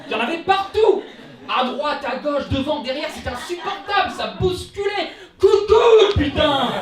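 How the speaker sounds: exasperated rant building to a high, full shout; comic tirade boiling over into yelling; carried-away rant. Acted. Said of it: "je me suis laissé emporter".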